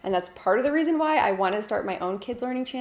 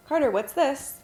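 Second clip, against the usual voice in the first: put-on higher, questioning voice for a child; asking a toddler; 6 semitones higher than usual. Shown as a demonstration. Mom quizzing her toddler Carter, high and inviting. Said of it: "what I'll do is I'll say".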